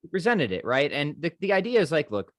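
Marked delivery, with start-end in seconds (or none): none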